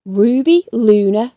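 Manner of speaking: usual speaking voice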